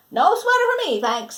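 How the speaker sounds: lightly voiced man turning down the sweater; Mister Crabtree. Mister Crabtree's quoted refusal.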